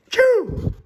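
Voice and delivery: high, loud "choo" burst; acted sneeze. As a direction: fake a big explosive sneeze. Gerald sneezes in the story.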